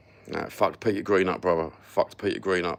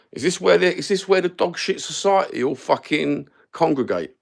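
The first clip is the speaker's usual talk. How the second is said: louder, higher indignant rant; 9 semitones higher, 6 dB louder than usual. Real, not acted. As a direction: rail at the dog shit society. Ranting about content creators.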